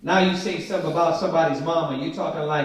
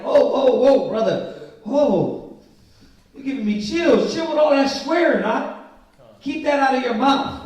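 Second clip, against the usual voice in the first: mock alarm; 6 semitones higher, 3 dB louder than usual. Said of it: "It's like".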